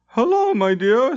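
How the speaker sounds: distracted greeting. A narrator's reading. Limbeck greets Jarre.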